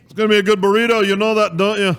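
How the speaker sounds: high, loud, quick Scottish banter; mock Scottish accent. Joining co-host's burrito riff, loud mock Scottish accent. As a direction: rattle it off as a caricature Scot. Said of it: "what a Scottish accent would sound like".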